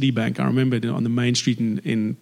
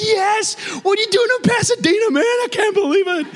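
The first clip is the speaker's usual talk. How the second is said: very high, shouted fan squeal; excitable bank teller. Acted. Teller amazed to meet the movie man in Pasadena, wildly excitable. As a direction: shriek in starstruck disbelief. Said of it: "there's this excitable, um, teller".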